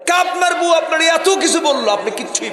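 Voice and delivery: high, loud mock complaint; hammed-up loud indignation; acted protest. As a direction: blurt it out high as an aggrieved visitor.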